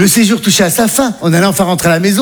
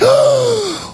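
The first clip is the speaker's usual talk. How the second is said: high gasp. Acted. Sudden gasp.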